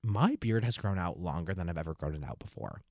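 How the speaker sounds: usual speaking voice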